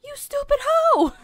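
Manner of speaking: hammed-up furious mother, high and very loud; mock angry shout. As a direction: yell it in high-pitched pretend outrage. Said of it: "Yeah, she's mad".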